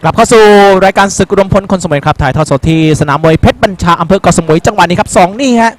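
normal talking voice

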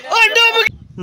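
high excited cry; excited cry at a friend going under. Real, not acted. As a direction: call it out as he goes under. Friend sinks in canal.

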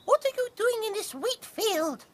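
very high tiny mouse; squeaky mouse voice. Acted. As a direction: squeak it like little mice. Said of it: "called four field mice".

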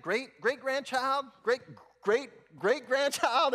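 hammed-up shocked usher; incredulous usher. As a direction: sound stunned at each great. Usher shocked at old mother.

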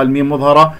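ordinary talk

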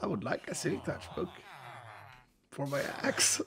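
rasping through a wrecked throat; lost voice. Real, not acted. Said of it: "Your voice was, like, completely ruined".

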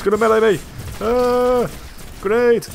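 very high, loud panicked cries; panicked shouting. Real, not acted. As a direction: scream out in panic. Enemy attacking, panic.

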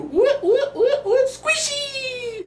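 high squealing whoops with a laugh; giddy whooping. Acted. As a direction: cheer the bounce like a party. Bouncing belly, cheering himself on.

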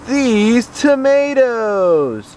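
high wailing mock sob, very slow; fake crying. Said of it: "Just kidding".

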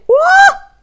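loud, very high "uah!"; playful high-pitched wow; high amazed whoop. Acted. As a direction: let out a sudden high squeal. Exclaims in mock amazement.

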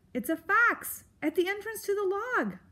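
high-pitched worried mouse; urgent little creature voice; anxious explanation. Acted. Mouse warns of fox at the entrance, anxious.

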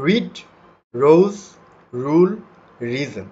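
রিড, রোজ, রুল, রিজন with a clearly sounded r at the start; clear initial r. Shown as a demonstration. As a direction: model each word, sounding the r that opens it. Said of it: "যেটা দেয়া আছে". (শুরুর র স্পষ্ট করে উচ্চারণ)

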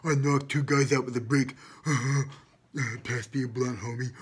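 high, loud gangsta caricature. Acted. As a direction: ham up a boasting street rapper, laughing.

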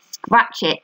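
ordinary delivery, nothing marked

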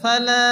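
فلا with the لا held one beat; lengthened la. Shown as a demonstration. Teacher showing students the one-alif stretch. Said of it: "এক আলিফ টেনে পড়তে হবে".

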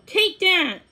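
high, loud battle challenge; fighting taunt. A narrator's reading. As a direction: goad the opponent mid-fight. Prince challenges toad in sword fight.

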